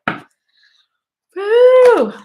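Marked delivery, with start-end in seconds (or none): 1.3-2.1 excited whoop. Real, not acted. Card jumps out of deck.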